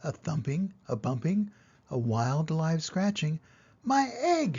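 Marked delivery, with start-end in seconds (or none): 3.8-4.6 high excited cry; the elephant crying out in amazement; shouted. A narrator's reading. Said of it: "shouted Horton".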